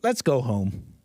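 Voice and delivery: mock-tender let's go home; a gentle pretend plea; sarcastic. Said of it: "I'm being ridiculously sarcastic".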